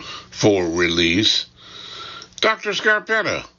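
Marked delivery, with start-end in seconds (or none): 2.4-3.5 high, brisk British secretary calling a name; British secretary cutting in, high and fast; officious British secretary. A narrator's reading. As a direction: interrupt officiously, pitched well up, quick. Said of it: "my officious British secretary interrupts".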